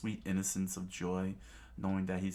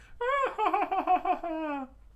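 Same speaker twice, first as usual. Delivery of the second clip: mocking laugh; 5 dB louder than usual.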